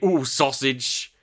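slow, drawn-out mock delight over a sausage; mock relish. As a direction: savour it slowly, hammed up. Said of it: "We don't just think".

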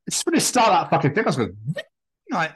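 ordinary delivery

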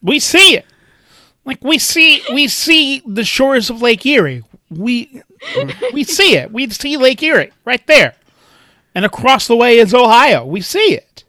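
high-pitched, insistent comic voice; high mock-earnest boasting; mock insistent local. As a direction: point it out proudly in a high, put-on voice. Acting out the Ohioan, high-pitched bit.

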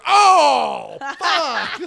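loud, high, raw cry of dismay; shocked outburst. Retold or imitated. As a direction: let it burst out like pure emotion. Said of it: "like, pure emotion".

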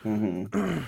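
ordinary delivery

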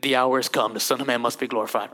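deep, quiet, grave Jesus; solemn Jesus voice. Acted. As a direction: drop low and hushed, prophetic. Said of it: "Then he just keeps on talking".

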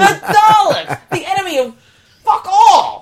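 excited shout. Real, not acted. Mocking the Dalek episode.

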